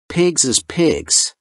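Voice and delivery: firm. A narrator's reading. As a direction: state it firmly and deliberately, stretching the words. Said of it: "Flannery said firmly".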